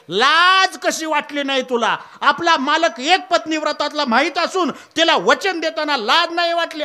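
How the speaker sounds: angry scolding. Acted. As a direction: scold him hard for the shameful promise. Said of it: "Ram एवढे रागावले".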